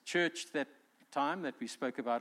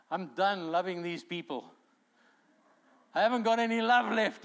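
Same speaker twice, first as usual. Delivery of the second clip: building to a higher, loud complaint; hammed-up fed-up outburst to God; acted exasperation; 4 semitones higher, 6 dB louder than usual. Believer out of love complains to God, acted exasperation.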